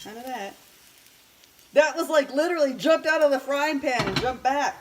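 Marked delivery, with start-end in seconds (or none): 1.7-4.8 excited. Real, not acted. Plantain jumped out of pan.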